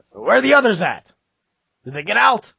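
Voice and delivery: high, loud urgent questioning; demanding guard. Acted. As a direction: call out as an alarmed guard. Guard questions escapees about others.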